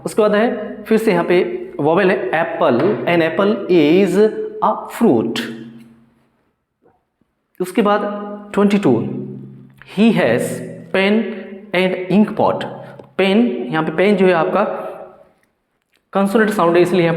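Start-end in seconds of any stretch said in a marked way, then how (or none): 3.1-5.4 slow dictation. Shown as a demonstration. Teacher dictates example sentence. 10.0-12.6 slow dictation. Shown as a demonstration. Teacher dictates example sentence.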